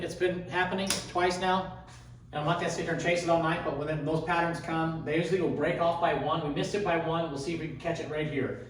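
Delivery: normal talking voice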